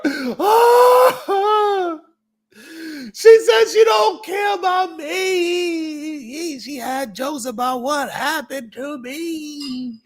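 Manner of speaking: high, slow hammed-up sobbing shout; mock crying. Upset viewer complaining about being blocked, mock sobbing. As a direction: wail it like a wounded viewer. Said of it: "They be really crying".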